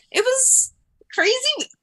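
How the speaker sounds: high, slow, stretched-out amazement; crazy said high and drawn out; amazed squeal. Real, not acted. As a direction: squeak it out in disbelief. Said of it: "laughing disbelief".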